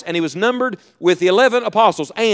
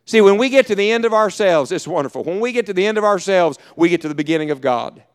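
ordinary talk, nothing marked

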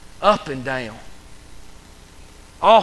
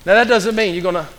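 ordinary talk, nothing marked

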